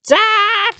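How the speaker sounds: put-on very high girl's scream; high-pitched scream calling dad. A narrator's reading. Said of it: "Nicole screamed".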